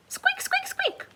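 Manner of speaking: very high, soft squeaks; squeaking hamster. Acted.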